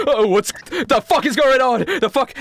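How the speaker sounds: high, loud alarmed outburst; acted panic; panicking. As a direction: freak out in a high voice. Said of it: "like, properly panicking".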